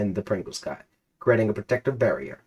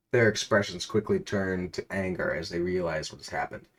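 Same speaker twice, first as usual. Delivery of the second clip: usual speaking voice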